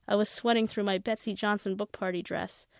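ordinary delivery

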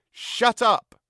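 loud, high angry yell. Acted. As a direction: yell it at your mother. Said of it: "yelled Joe".

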